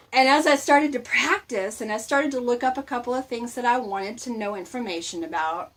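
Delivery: ordinary talk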